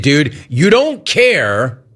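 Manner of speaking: usual speaking voice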